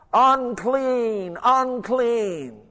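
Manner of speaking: loud, high drawn-out leper's cry. Acted. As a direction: call it out high and loud as a warning to everyone. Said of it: "and cry out".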